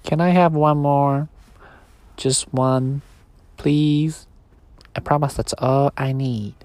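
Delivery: a tiny voice begging not to be left; small toy voice. Acted. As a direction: toy bear begging the boy, small pleading voice. Sleepy's voice.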